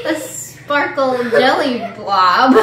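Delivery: giggling through the words; laughing. Real, not acted. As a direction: laugh while describing it. Laughing at weird candy.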